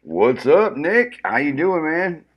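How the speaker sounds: normal talking voice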